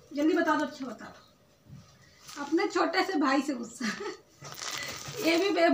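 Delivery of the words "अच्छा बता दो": whisper. Real, not acted. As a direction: breathe it softly to coax an answer. Coaxing child to tell secret. (फुसफुसाकर मनाते हुए)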